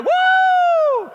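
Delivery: very high shouted woo; mock crowd cheer, high and loud; imitated crowd whoop. Crowd cheering in retold story.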